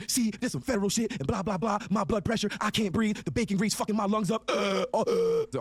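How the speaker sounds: high-pitched panicked mock voice; hammed-up high, strained freak-out; Munfat. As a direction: imitate someone tweaking and short of breath, ending in wheezy grunts. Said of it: "Munfat starts tweaking".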